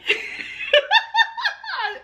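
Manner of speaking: high-pitched burst of laughter. Real, not acted. Laughing at herself before the challenge. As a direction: break into a giggle. Laughing at her own stalling.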